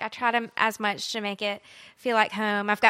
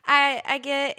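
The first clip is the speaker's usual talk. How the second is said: ordinary talk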